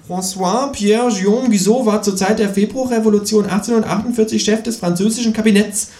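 high, showing off trivia; high-pitched quiz champion kangaroo; know-it-all kangaroo. A narrator's reading. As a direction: reel off the facts in a high, smug voice. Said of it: "punkte das Känguru mit seinem Trivial Pursuit Wissen".

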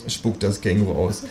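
ordinary delivery

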